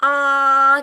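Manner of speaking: Ah held high and level, loud; Ah on a steady high pitch; tone one. Shown as a demonstration. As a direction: model Ah high and flat.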